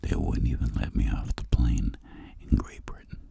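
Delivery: ordinary talk, nothing marked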